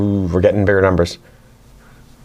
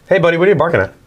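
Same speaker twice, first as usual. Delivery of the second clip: raised friendly pet voice; talking to the dog; 8 semitones higher, much faster than usual. Real, not acted. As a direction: ask the dog kindly, pitched up.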